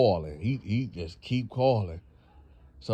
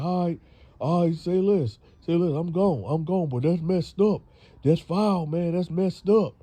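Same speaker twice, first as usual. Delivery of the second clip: offended exit; 7 semitones higher, 5 dB louder than usual. Retold or imitated. Boyfriend leaves, offended.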